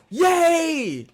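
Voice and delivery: high mock cheer; hammed-up, high-pitched cheering; mock celebration. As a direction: imitate a commentator celebrating. Acts out announcers celebrating.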